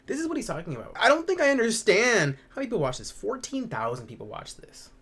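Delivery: high, loud disbelieving rant; incredulous outburst. Real, not acted. Streamer reacting to how many people watched, incredulous outburst. Stunned outburst over the video's audience.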